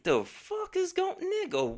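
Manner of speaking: very high squeaky disbelief; shocked disbelief. Retold or imitated. Seeing the couple booed up after the fight, high shocked squeal. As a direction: squeal it like you can't believe it.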